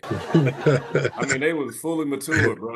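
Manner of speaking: laughing while talking; chuckling through the joke. Real, not acted. Joke about ingrown hairs.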